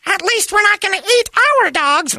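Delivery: very high, put-on wife voice, blurted out; wife's retort impression. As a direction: mimic his wife snapping back, way up high. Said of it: "Megan said".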